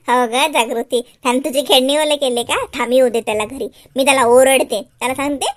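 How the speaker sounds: low motherly voice; Shyam's mother. Acted. Mother promises Jagruti to scold Shyam, low mother voice. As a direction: play the mother, pitched well down.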